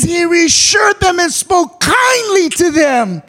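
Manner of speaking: preached loud. Shown as a demonstration. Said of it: "It said kind".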